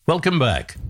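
normal talking voice

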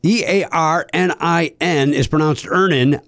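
letters "E A R N I N" spelled out slowly, then "Earnin"; spelling it out. Shown as a demonstration. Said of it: "let me spell that for you".

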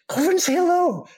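high, fast imitation of an insistent wife; excited urging. Wife pushing husband to greet Michael, excited urging. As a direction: mimic her urgent, high-pitched nudge.

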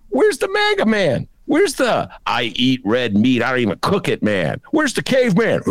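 high, exaggerated plea for the old Bailey; mock exasperated call. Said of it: "I'm like".

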